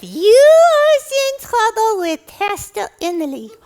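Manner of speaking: put-on high, loud childlike puppet voice; gleefully tattle in a squeaky puppet voice; high-pitched little tattletale; teasing puppet. Puppet teases the host.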